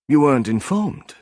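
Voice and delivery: a lightly voiced German academic, cool and polite. A narrator's reading. Arthur's voice again.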